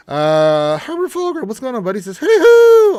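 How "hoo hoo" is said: a loud, high hoo-hoo whoop; hooting whoop. Retold or imitated. Streamer voicing a viewer's greeting as a loud high hoot. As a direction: hoot it out like an owl gone wild.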